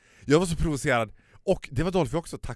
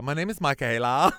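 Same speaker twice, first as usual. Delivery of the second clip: mock American; 3 semitones higher, 5 dB louder than usual. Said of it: "älska att få prata amerikanska".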